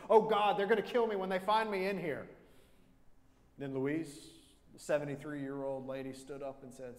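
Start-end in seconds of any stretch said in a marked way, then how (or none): 0.1-2.3 loud, high panicked cry. Retold or imitated. Gunman panicking as the police cars arrive. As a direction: blurt it out in a panic. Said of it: "started panicking and said".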